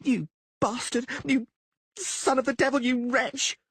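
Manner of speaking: high, shrill-free furious yelling from a balcony. Acted. Blonde woman yells curses at Dandelion from the house, furious. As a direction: yell abuse at her cheating lover. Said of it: "The plump blonde yelled".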